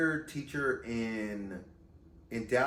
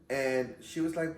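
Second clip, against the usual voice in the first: normal talking voice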